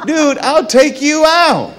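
hammed-up indignant retort; indignant outburst. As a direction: play the offended disbelief, half joking. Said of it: "I'm like".

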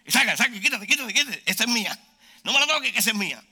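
comic territorial outburst; greedy mock squabble. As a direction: fend off hands from your food. Acting greedy grab for food.

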